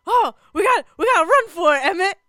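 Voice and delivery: high, loud, frantic mother; panicked mom calling her son; Ma's panicked voice. Retold or imitated. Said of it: "Ma is like".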